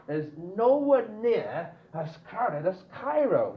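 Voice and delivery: "nowhere near" high, slow and stressed; emphatic NOWHERE NEAR. Shown as a demonstration. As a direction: stretch "nowhere near" for a stronger denial. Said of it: "stronger than not".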